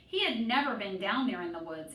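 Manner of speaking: usual speaking voice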